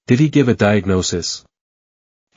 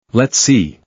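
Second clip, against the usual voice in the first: evil smile. A narrator's reading. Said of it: "he said while smiling evilly".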